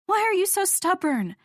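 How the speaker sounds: fed up with his stubbornness; high, exasperated question. Acted.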